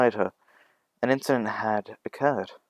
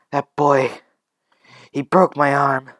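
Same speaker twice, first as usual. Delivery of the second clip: loud, higher pained blame; hurt, outraged accusation; angry accusation; 4 semitones higher, 7 dB louder than usual. Acted. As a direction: point out the culprit loudly and bitterly. Injured woman accuses the boy.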